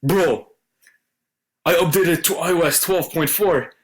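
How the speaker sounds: hyped-up reading of a friend's text; excited text read-out. Retold or imitated. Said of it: "it goes as follows".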